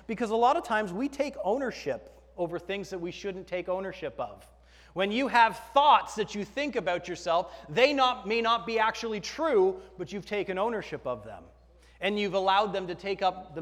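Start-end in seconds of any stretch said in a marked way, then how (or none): none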